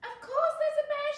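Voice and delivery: very high, loud squeal; squirrel shout. A narrator's reading. Squirrel dismissing Fox's warning, high shout. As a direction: shout it gleefully over everyone. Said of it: "shouted the squirrel".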